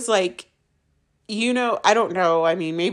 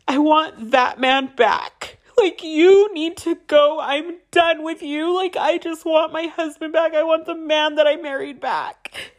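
higher, pleading, voice straining upward; desperate plea; 7 semitones higher than usual. Retold or imitated. Wife begging her husband to come back, high and desperate. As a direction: beg him to give back the man she married.

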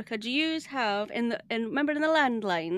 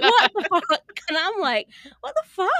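loud, high-pitched mock outrage breaking into laughter; laughing exasperation; 7 semitones higher, 6 dB louder than usual. Guest retelling her comeback to her sister, laughing and exasperated. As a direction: protest incredulously, laughing through it.

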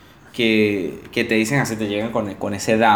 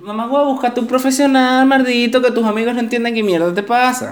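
higher-pitched exasperated rant; worked-up rant; 9 semitones higher than usual. Real, not acted. Telling needy friends to see a professional, exasperated. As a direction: vent at friends who dump on you.

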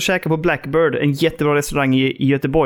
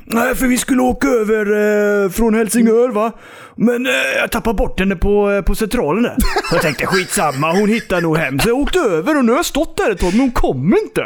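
weird stranger. Retold or imitated. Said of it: "En riktig jävla kuf".

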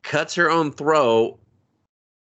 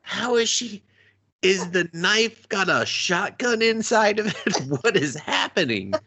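high baffled disbelief; 7 semitones higher than usual. Retold or imitated. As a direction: act totally bewildered at the screen. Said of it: "I was like".

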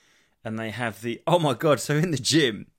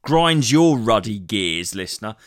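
louder, slow "grinds your ruddy gears"; stressed grievance; 9 dB louder, slower than usual. Real, not acted. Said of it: "tell me if this".

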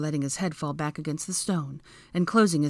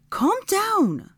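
high, sharp command; ordering; 4 dB louder than usual. A narrator's reading. Hermione to panicking Draco, ordering. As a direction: order it firmly, pitched high. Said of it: "Hermione ordered".

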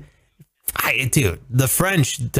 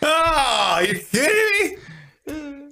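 high, squeaky cry of disbelief; hammed-up you-kidding-me; joking shock at a cohost's innuendo, high squeal; mock disbelief; 13 semitones higher, much slower than usual. Real, not acted. Mock outrage.

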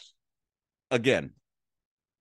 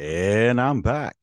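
back from a call; 6 dB louder, much slower than usual. Real, not acted. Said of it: "I got a call".

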